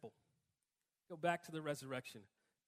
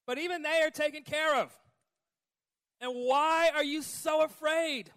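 preaching climax; 9 semitones higher, 13 dB louder than usual. Real, not acted. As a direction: drive it home with full force.

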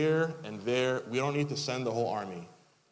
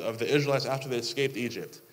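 ordinary talk

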